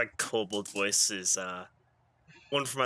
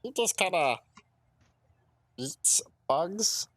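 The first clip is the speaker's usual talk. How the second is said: goblin voice; 6 semitones higher, slower than usual. Pretend. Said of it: "that's your goblin voice. This one's mine".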